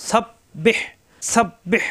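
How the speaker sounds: সাব্বিহ with doubled B and a pressed, breathed H; throat-pressed H. Shown as a demonstration. Teacher drilling সাব্বিহ for learners, throat-pressed ending. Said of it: "এখানে কণ্ঠনালীতে চাপ হবে".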